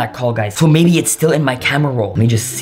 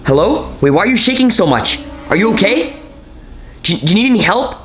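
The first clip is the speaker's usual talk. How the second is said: high, anxious questions to a caller; worried, pitched-up pleading for an answer; alarmed questioning; 9 semitones higher than usual. Acted. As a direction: urgently check if they're okay.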